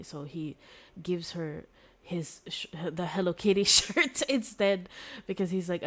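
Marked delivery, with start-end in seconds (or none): 2.9-4.9 laughing through the words; giggling as you say it. Real, not acted. Laughing aside.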